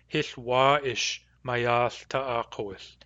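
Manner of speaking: Hith wa ish mayath ta'akowith, said slowly with a long wa ish; Nuu-chah-nulth phrase. Shown as a demonstration. Teacher reporting the news to Lindsay as Hith wa ish mayath ta'akowith. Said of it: "the vowel of wa ish is long".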